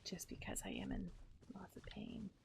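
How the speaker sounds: hushed, breathy admission of pain; pained whisper. Real, not acted. Streamer quietly telling chat about her pain, whispering. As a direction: whisper it as if it hurts.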